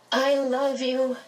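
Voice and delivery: high, slow tearful plea; tearful cry of love. A narrator's reading. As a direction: cry it out, voice lifting. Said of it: "she cried".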